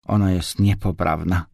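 usual speaking voice

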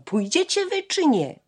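sharp high yell; brother's shout. A narrator's reading. Older brother Mietek yelling at his sisters, high impatient shout. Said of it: "więc krzyknął".

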